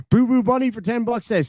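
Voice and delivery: usual speaking voice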